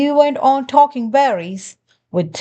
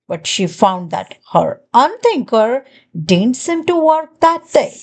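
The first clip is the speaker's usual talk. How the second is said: plain reading voice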